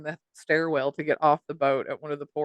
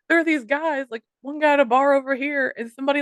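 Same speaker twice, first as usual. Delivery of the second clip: ordinary delivery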